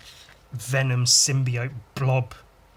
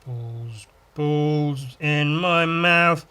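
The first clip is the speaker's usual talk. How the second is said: slow, louder, put-on muffled voice; voicing cartoon character; 3 semitones higher, 5 dB louder, much slower than usual. Drawn character with balls in mouth, put-on voice. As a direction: drag it out like a mouth full.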